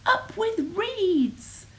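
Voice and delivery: high, slow hooted cheer; drawn-out dinosaur slogan, pitched high; joyful hoot. A narrator's reading. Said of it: "And they hooted".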